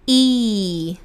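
"I" as a long, pure ee; E as Brazilian I. Shown as a demonstration. Teacher modelling English letter E, long ee sound. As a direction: hold "I" like the Brazilian Portuguese i. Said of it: "tem a pronúncia do nosso I brasileiro".

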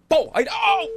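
mock explosion and cry of pain; explosion noise. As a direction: shout a sudden bang then a yelp. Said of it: "aí faz um barulho de explodindo".

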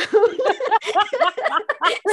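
high, loud real laughter; high giggling fit. Real, not acted. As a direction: break into helpless giggles.

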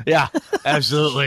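loud, high, laughing reply; cracking up while agreeing; real laughing agreement. Real, not acted. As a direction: agree heartily through laughter. Laughs at the co-host's joke.

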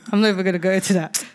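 quick, laughing brush-off; laughing, fast dodge of the topic. Real, not acted. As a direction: rattle it off through a laugh. Laughing off an embarrassing story.

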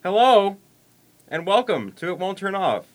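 high, very loud show opening; shouted welcome. Real, not acted. Host opens the podcast for listeners, shouted welcome. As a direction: scream the greeting into the mic. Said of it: "Today we scream into the mic".

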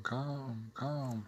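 hushed echo tail. Shown as a demonstration. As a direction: bounce the word back as a faint echo. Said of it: "the echo repeats only the last word".

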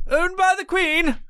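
high, put-on posh British voice; hammed-up British toff accent; mock British accent. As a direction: play a loyal subject of the Queen. Mocking the British crown.

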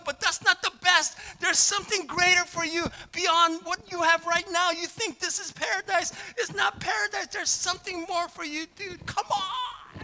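very high, loud, exasperated pleading; hair-pulling frustration, shouted at a friend; frantic preacher crying out at full force; preaching like crazy. Retold or imitated. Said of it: "was preaching like crazy in my dream".

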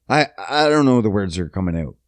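ordinary talk

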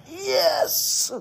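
high, delighted "Yes!" in imitation; mock ecstatic yelp. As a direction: squeal it like a thrilled kid. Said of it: "So ecstatic".

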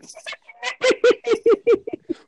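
high loud cackling laugh; shrieking laugh. Real, not acted. Bursts laughing.

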